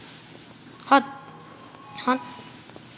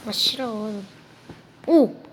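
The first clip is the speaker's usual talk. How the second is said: normal talking voice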